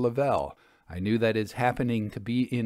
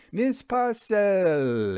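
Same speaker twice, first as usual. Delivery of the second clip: higher, slow and stretched-out greeting; hearty hail; 9 semitones higher, 4 dB louder, much slower than usual. Acted. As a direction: hail her name across the dressing room. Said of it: "he hailed".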